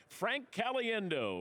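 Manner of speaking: slow, high, drawn-out announcer call of the name; Terry Bradshaw impression. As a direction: stretch the name out like a hyped football broadcaster. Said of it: "or as Terry Bradshaw says".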